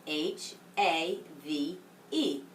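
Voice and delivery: slow spelling. Shown as a demonstration. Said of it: "it's spelled".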